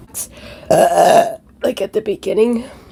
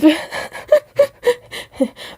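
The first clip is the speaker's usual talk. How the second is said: high breathy laugh; 9 semitones higher than usual. Real, not acted. Laughs after the burp.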